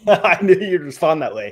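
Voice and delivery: laughing at a co-host's predictable reaction. Real, not acted. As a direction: say it through real laughter. Joking with co-host, laughing.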